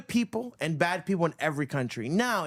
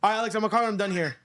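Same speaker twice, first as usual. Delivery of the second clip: quick, hurried brush-off; dodging a caller; 3 semitones higher, much faster than usual. Real, not acted. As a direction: rush them off the line. Hurrying a caller off the phone.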